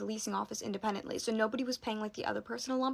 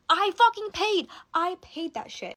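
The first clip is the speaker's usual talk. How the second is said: high, loud and slow, real anger boiling over; furious outburst; 7 semitones higher, 5 dB louder, slower than usual. Real, not acted. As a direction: spit out every word, fed up. Furious rant climax.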